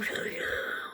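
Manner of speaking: breathy laugh. Real, not acted. Laughs at own story.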